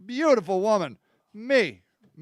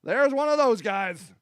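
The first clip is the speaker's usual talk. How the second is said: mock alarm; 8 semitones higher than usual. Spotting a Confederate fan, warning everybody in mock alarm. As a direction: sound the alarm, half joking, to the room. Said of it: "is you're like".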